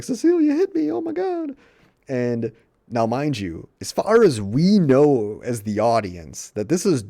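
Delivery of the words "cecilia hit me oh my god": much higher, louder mock wail; hammed-up shriek. Sydney telling her dad Cecilia hit her, hammed-up shriek. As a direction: overplay the hurt teenager. Sydney accusing after slap.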